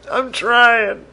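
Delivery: high, slow, hammed-up crying whine; mock sobbing whine. Host fake-wailing about his bad podcast, hammed-up sobbing. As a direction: wail it like a fake breakdown.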